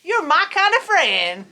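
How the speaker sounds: a lively rodeo holler; cheery shout. A narrator's reading. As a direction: call it out like a friendly cowboy. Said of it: "and shouted".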